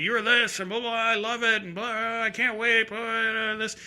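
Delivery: put-on high-pitched drunk gushing on and on; high, run-together tipsy babble; rambling drunk fan. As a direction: imitate a drunk fan's endless blurry praise. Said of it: "And the guy's drunk".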